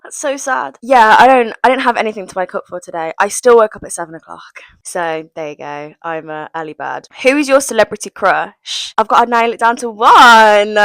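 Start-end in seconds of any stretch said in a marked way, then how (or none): none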